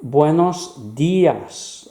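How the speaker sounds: "Buenos días" slowly, with DÍ stretched; stress on í. Shown as a demonstration. Teacher showing where emphasis goes, slow "Buenos días" stressed on DÍ. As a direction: lean long on the í of "días". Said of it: "it's where you place the emphasis".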